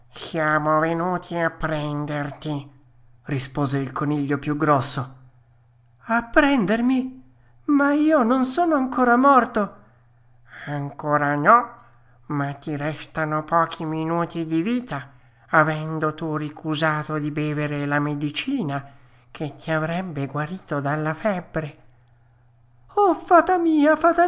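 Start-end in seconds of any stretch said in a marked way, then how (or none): 0.1-2.7 slow, put-on undertaker voice; ominous rabbit. 6.0-9.8 high, loud puppet alarm; alarmed protest. Acted. Puppet told he is being taken, high alarmed protest. Frightened Pinocchio protests to the rabbits. 10.6-21.8 put-on creature voice pronouncing doom; rabbit undertaker, ominous. Biggest rabbit announcing death, ominous creature voice.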